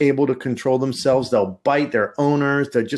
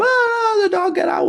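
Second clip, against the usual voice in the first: high panicked cry. Retold or imitated. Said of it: "running around looking".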